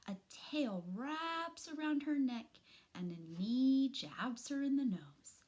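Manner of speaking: ordinary delivery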